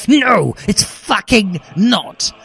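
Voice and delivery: outraged high yell, slowed. A narrator's reading. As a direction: shoot up and yell it. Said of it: "I yelled as I stood up".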